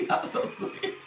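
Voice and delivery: laughing through "Y'all so sweet". Real, not acted. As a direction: let a real giggle carry the words. Thanking viewers mid-laugh.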